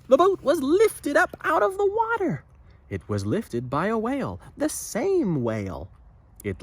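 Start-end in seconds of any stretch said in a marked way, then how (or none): 0.1-2.4 high, louder gasping reveal; spring the surprise on the children; dramatic surprise. A narrator's reading.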